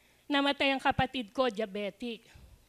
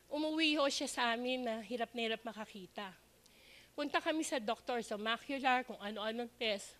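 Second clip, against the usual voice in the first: ordinary talk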